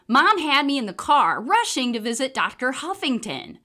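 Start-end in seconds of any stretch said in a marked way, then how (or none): none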